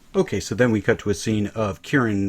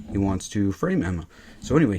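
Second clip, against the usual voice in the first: ordinary delivery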